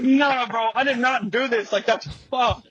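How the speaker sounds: high-pitched retold protest; dismayed denial. Valet insists he didn't break the car, dismayed denial. Denies blame for the broken car.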